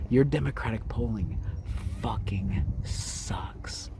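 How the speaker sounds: hushed, falling to a drawn-out whisper; secret, whispered. Pretend. Host tells listeners a secret about polling, whispering. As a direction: murmur it, then whisper the last words slowly. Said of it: "let me tell you guys a little secret".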